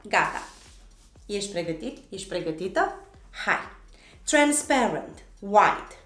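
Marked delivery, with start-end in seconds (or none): 4.2-6.0 each English word set apart, emphatic swinging tune; repeat-along pronunciation drill. Shown as a demonstration. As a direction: lead learners through a colour list, word by word. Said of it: "pronunți totul odată cu mine". (model de pronunție, rostit apăsat, cu pauze)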